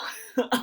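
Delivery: short laugh. Real, not acted. Laughing at herself alone.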